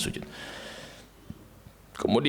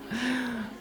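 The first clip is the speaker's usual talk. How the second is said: short laugh; 11 semitones higher, 8 dB quieter than usual. Real, not acted. Laughs again.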